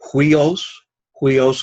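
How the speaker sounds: "Huios" begun with an aspirated h, hwee-os; rough breathing. Shown as a demonstration. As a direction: demonstrate the h at the front of "Huios". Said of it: "it's a rough breathing".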